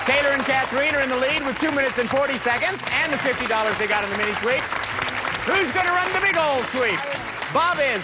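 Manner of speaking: high-pitched game show hype; excited host. Real, not acted. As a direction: build excitement before the break, pitched high. Host hypes teams before the break.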